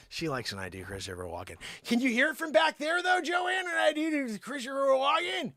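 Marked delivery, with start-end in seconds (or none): 1.8-5.5 high-pitched call to someone out of sight; high, sing-out question called down the hall; calling to back room. Real, not acted.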